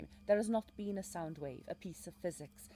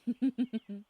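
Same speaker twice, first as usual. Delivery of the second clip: delighted laugh; 5 semitones higher, 10 dB louder than usual. Acted. Laugh alone on the line.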